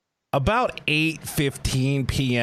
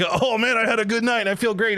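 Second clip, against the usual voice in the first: higher, bright and upbeat; mock cheerful; 8 semitones higher than usual. Host mocking a good-night hangover, higher upbeat. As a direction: sound falsely satisfied with the night.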